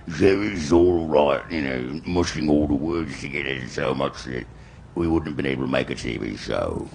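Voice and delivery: slow, slurred Cockney accent, loud at the start; mumbled Cockney accent. Shown as a demonstration. Voice actor demoing his first Cockney try, slurred Cockney. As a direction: mash every word together in thick Cockney. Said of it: "a Cockney kinda thing".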